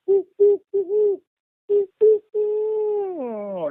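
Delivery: mock angry owl whooping that sinks at the end; angry owl hoots. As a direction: hoot like an agitated owl sounding a warning. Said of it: "it did, like".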